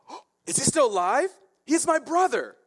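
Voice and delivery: high, loud amazed king; hammed-up astonishment and delight; acted surprise. Acting Ahab's astonished reply.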